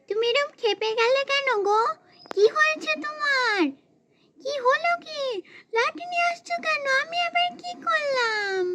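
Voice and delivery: put-on small whining child voice; innocent child. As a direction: plead innocence to an angry mother. Child asks angry mother what happened.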